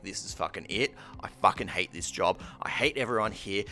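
low, muted acted grumble; seething inner voice. Worker fed up in the moment, acted low seething. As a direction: grumble it privately, building slightly. Inner thoughts before quitting the job.

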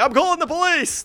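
high indignant threat; high shrill warning; mock threat. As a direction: imitate her threatening the police. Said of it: "she would be like".

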